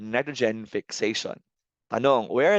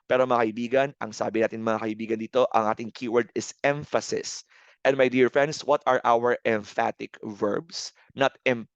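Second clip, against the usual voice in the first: normal talking voice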